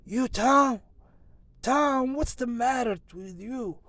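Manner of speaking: very high, loud, alarmed cry; gasped alarm. Acted. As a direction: cry out in shock at the bedside. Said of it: "she gasped out".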